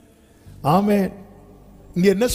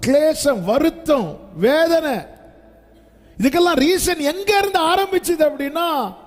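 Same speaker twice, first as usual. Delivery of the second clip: raised, fervent preacher's voice; preaching; 8 semitones higher than usual. Acted. Pastor stirring the congregation, fervent and raised. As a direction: pour out the list as a plea.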